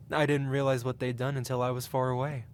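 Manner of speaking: ordinary delivery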